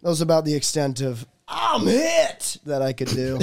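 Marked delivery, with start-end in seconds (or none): 1.5-2.6 high, shouted battle cry; American soldier. Shown as a demonstration. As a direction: cry out as a wounded video game soldier. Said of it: "an American soldier in a Japanese war video game".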